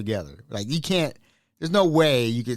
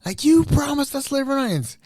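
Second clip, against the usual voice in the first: high-pitched eager mimicry; excited residents; 12 semitones higher than usual. Imagined residents demanding liver, high and eager. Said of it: "I will be like".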